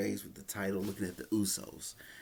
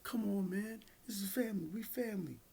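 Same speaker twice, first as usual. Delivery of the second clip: higher pleading voice, imitating Jimmy; mock pleading higher voice, hands-off plea; 10 semitones higher than usual. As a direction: talk him down like a worried brother, pitched up. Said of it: "he's like".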